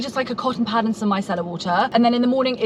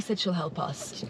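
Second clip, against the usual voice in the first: whispering; 3 semitones lower, 10 dB quieter, slower than usual. Real, not acted. Quiet aside, unvoiced.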